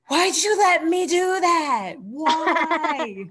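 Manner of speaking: mock whine. Wrestler backstage blaming partner, mock whining plea. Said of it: "you're like".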